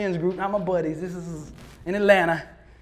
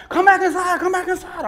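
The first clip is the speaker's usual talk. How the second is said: higher, excited urging; urgent call; 9 semitones higher, 4 dB louder than usual. Retold or imitated. Joe calling Billy back inside on the phone, higher and urgent. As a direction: hurry him back in.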